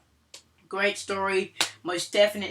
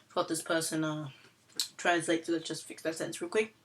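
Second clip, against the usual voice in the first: ordinary delivery, nothing marked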